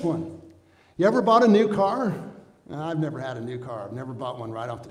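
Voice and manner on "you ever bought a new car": loud question. Real, not acted. Preacher's lively question.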